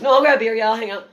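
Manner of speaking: high, loud, eager acceptance; comic thrilled that Bill Burr wants a beer, eager; eager yes. Retold or imitated. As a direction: jump at the invitation.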